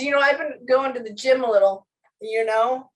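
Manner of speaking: a playful hint of bragging tough guy; macho dad voice. Medium voicing the dead father bragging about the gym, mock macho. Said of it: "trying to be this more macho version of himself".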